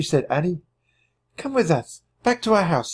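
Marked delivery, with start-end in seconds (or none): 1.4-2.9 high, gentle woman's voice; a French farmwife comforting hiding children; Suzette. A narrator's reading. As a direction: reassure the children as a kind mother.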